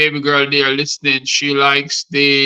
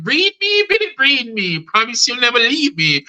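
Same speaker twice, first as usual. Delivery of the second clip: very high, pleading mock-female voice; mimicked lover; 7 semitones higher than usual. Helper begs Billy in bed, high mimicked lover's voice.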